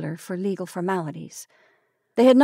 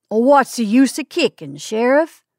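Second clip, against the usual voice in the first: mob leader arguing, question rising on Sheriff; 4 semitones higher, 6 dB louder, slower than usual. A narrator's reading. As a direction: push back at the sheriff, rising on Sheriff. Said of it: "argued one of the leaders of the mob".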